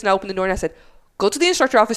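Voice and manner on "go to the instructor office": higher, firm teacher's command; retold raised voice. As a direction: send the student off sharply. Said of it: "I raised my voice".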